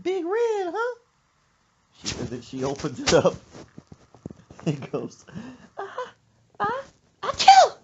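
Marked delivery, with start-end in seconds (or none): 0.0-1.0 high, loud demanding squawk; nosy mama voice. Retold or imitated. Mother questioning son about his bag, high squawky demand. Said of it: "She said". 5.8-7.8 building gasps into a loud high achoo; fake sneeze. Mother sneezing into son's soda, loud mock sneeze. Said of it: "And sneezes in it".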